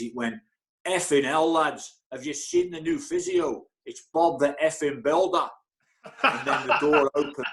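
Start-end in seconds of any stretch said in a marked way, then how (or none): 0.9-5.5 put-on booming Scottish accent. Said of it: "this booming voice in a Scottish accent". 6.2-7.5 amused, breaking into a laugh mid-line; laughing while talking. Real, not acted. Laughs while telling his story.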